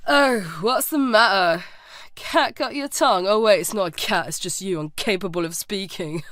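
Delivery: bully amused by his own jibe; laughing taunt. A narrator's reading. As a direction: taunt him and finish on a chuckle. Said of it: "laughing, said".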